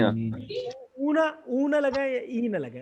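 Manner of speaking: teacher demonstrating اونا with a long, lifted oo; drawn-out ending sound. Shown as a demonstration. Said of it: "اونا کی sound لگا دے".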